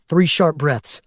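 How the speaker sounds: plain reading voice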